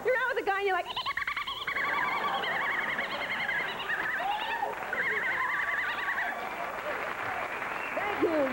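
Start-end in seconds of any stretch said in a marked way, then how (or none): 0.9-7.9 put-on long squeaky girlish giggle; high giggly laugh. Shown as a demonstration. Comic mocking a woman's nervous giggle on a first date, squeaky. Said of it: "women with giggly voices".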